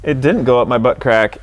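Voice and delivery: usual speaking voice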